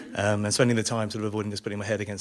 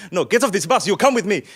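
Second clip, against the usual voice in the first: high, loud driver impression; irate bus driver; 7 semitones higher, 10 dB louder than usual. Bus driver commanding passengers to get off, irate. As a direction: order them about in a shouty mock voice. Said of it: "And the bus driver".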